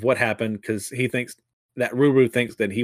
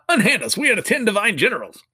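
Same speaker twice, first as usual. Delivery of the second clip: indignant protest; 9 semitones higher than usual. Acted. Generals shaking off the police, indignant high protest. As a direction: puff up with wounded pride.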